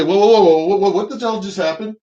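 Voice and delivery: sudden loud, high burst of whoas; acted double take, loud then easing off; startled disbelief. Passenger reacting to the sudden change of flags, startled.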